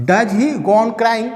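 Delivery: very slow, careful question; teacher reading a model question slowly. Shown as a demonstration. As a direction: read it slowly for students. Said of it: "model question".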